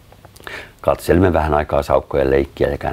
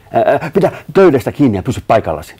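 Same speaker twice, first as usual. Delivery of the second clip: fast, loud, high instruction; urgent order; 8 semitones higher, 5 dB louder, faster than usual. A narrator's reading. Matti hands over the rope before the ambush, urgent order. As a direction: rattle off the command urgently.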